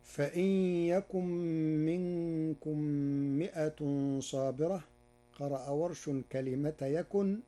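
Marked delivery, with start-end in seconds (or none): 0.0-4.8 chanting Quranic verses; Quran recitation. Acted. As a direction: intone the verse, lifting the pitch.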